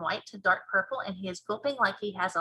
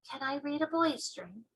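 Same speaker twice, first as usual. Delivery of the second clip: put-on soft, higher, eager young girl; 5 semitones higher, 6 dB quieter than usual. Girl asks the giant to read a dream, soft and high. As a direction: voice a girl asking softly.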